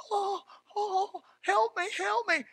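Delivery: high, thin whimper of a helpless worrier; mock helpless wail. Preacher mocking worriers who cry for help, in a high pitiful whimper. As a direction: whine it like someone begging for rescue.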